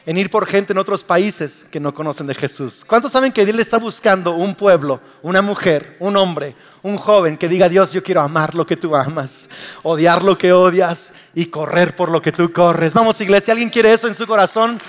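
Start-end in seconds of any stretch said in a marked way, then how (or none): 2.8-14.8 loud rousing altar call; loud impassioned preacher call to commitment; preaching at full force. Acted. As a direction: build the church to a response. Preaching climax.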